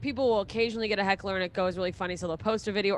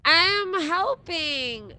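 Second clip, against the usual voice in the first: put-on very slow, high, drawn-out drunk; belligerent drunk; 7 semitones higher, 4 dB louder, much slower than usual. Drunk heckler defending themselves, slow high drawn-out slur. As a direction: stretch it out like an incoherent drunk. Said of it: "someone who's belligerently drunk".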